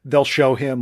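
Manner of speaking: ordinary talk, nothing marked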